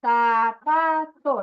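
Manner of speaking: "sapato" bem devagar, sa... pa... to; contagem de sílabas. Shown as a demonstration. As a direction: separe cada sílaba de "sapato", como toques de sininho. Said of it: "ela vai tocar assim". ("sapato" sílaba por sílaba, pausado)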